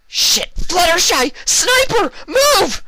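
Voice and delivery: very high, loud panicked shout; shouting a warning. A narrator's reading. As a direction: warn her of the sniper at full voice. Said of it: "she shouted".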